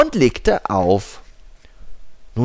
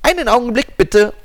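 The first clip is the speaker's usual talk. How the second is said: secretary voice; 13 semitones higher, 5 dB louder than usual. Acted. Secretary on phone.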